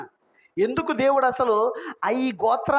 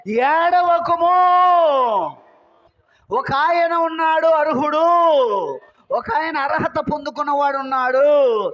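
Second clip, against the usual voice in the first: elder's exhortation; 5 semitones higher, 6 dB louder than usual. Retold or imitated. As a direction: proclaim good news as the consoling elder. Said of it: "పెద్దలలో ఒకడు ఏమన్నాడు?".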